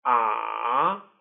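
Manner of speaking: "Ah" dipping low, then rising; long falling-rising swoop on "Ah"; third tone. Shown as a demonstration. Teacher demonstrates third tone, dipping "Ah". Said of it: "also called the falling rising tone".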